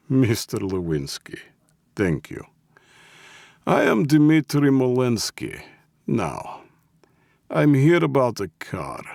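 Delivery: put-on polite Russian-accented introduction; heavy Russian accent. A narrator's reading. Russian boss introducing himself to the old man, heavy Russian accent. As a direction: introduce yourself formally in a thick Russian accent. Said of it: "in a heavy Russian accent".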